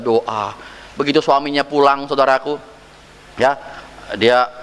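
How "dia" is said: ordinary talk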